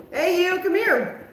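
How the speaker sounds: high, loud summons; calling someone over. Real, not acted. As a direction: shout over to get their attention.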